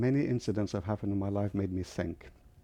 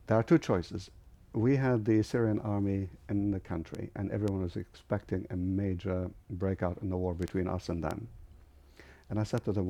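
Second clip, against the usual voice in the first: normal talking voice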